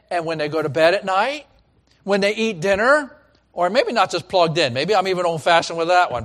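normal talking voice

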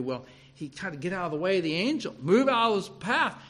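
ordinary talk

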